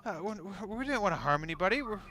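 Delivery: high, nervous stammering excuses; panicked entertainer. Acted. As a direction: babble excuses in a rising panic. GM voices panicked entertainer, high pitch.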